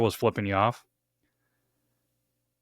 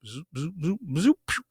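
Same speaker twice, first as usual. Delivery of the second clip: game meter noises; 6 semitones higher than usual. Retold or imitated. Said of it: "imitating game meter sounds".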